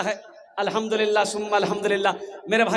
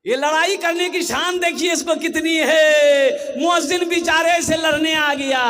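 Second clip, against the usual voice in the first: loud, raised-pitch preaching rebuke; indignant scolding; 5 semitones higher, 5 dB louder than usual. Real, not acted. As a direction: cry out at their nerve. Preacher scolds those picking a fight.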